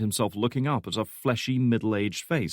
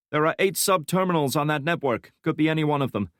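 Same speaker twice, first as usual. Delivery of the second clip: lightly voiced young analyst, higher; young analyst Santiago; 7 semitones higher than usual. A narrator's reading. Young analyst reporting to his boss, lightly higher voice. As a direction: report it briskly, eyes on screen.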